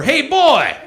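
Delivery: loud, high-pitched hillbilly holler; cartoon hillbilly. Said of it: "cartoon hillbilly".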